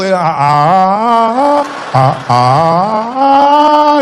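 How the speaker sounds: swelling mock cry, held long; finding the level. Shown as a demonstration. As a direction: test the wail like tuning a note. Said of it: "you find the level".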